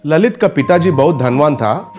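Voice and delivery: ordinary delivery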